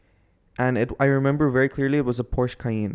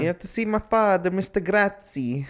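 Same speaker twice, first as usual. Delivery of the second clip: put-on butchered Italian accent; 7 semitones higher than usual. Storyteller playing lost driver seeking his father, butchered Italian accent. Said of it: "I don't wanna do the accent".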